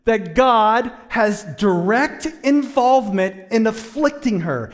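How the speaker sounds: loud, high, building sermon delivery; full-force preaching. Real, not acted. Pastor pressing the congregation on God's role, loud and rising. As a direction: drive the point home from the pulpit.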